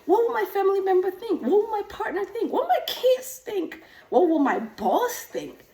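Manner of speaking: fretting over what everyone thinks; lightly voiced worried client; anxious clients. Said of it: "they come to say".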